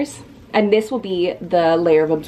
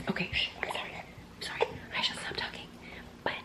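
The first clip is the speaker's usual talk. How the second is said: whispering; 18 dB quieter, faster than usual. Real, not acted. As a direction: keep it to a whisper. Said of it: "when I don't have to whisper".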